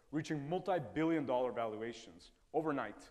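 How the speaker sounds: usual speaking voice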